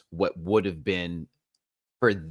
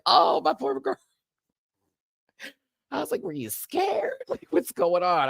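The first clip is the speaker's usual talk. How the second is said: mock pity.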